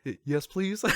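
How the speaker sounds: high, meek little-kid answer, lightly mimicked; childlike plea. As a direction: imitate a child sheepishly accepting help. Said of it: "you're like a child".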